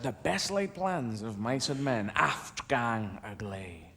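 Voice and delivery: low, soft, slow verse recital; hushed, drawn-out poetry quotation; reciting Burns. Acted. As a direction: intone the famous Scots line slowly and softly. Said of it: "one of his most famous lines".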